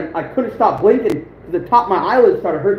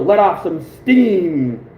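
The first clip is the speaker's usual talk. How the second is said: very slow, stretched-out movie quote; action-hero one-liner; 3 semitones lower, much slower than usual. Acted. Quoting a Commando one-liner to viewers, slow and drawn out. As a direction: draw the catchphrase right out, especially the end. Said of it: "the other one also".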